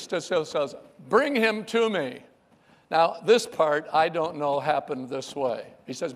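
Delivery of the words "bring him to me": higher, louder calm command; commanding call. Acted. Jesus calling to the crowd, firm command.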